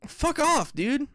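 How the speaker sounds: high, exasperated fuck off; annoyed outburst. Real, not acted. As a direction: snap it out, fed up. Ranting about parking tickets.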